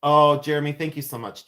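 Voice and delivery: ordinary talk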